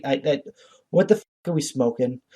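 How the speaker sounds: ordinary delivery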